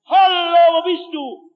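high call fading out; rescuers calling. Retold or imitated. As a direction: call out into the distance. Retter rufen wieder. (rufend in die Ferne)

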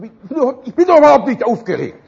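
high, very loud indignant shout; agitated outburst. Acted. Husband snapping back at his wife, agitated shout. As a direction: explode in comic denial of being worked up. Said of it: "Sei doch nicht so aufgeregt".

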